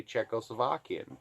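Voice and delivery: ordinary talk, nothing marked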